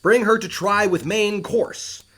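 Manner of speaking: slow, loud and high ship's command; shouted order. Acted. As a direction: call it over the wind. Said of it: "he's calling out more things to do".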